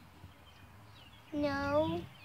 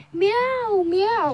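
mock meow; 4 semitones higher, 6 dB louder than usual. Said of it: "She's like".

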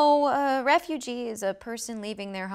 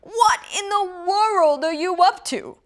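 donkey voice; 9 semitones higher, 6 dB louder than usual. Acted. Donkey asks rooster.

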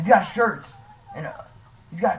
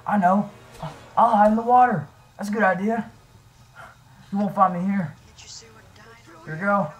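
ordinary talk

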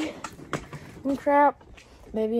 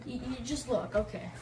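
hushed; 10 dB quieter than usual. Real, not acted. Keeping secret from parents.

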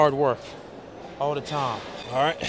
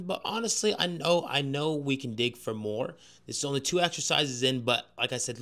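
quiet talk; 3 semitones lower, 8 dB quieter than usual. Real, not acted. Quieter talk after the set.

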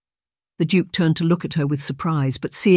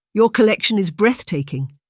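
steady, admiring and higher; confident admiration; 6 semitones higher, 4 dB louder than usual. A narrator's reading. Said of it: "steady, confident tenor of her voice".

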